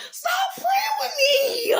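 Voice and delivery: high, slow, cracking yell; a pleading, breaking shout; anguished shout. Real, not acted. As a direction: scream it out in frustration. Heated rant peak.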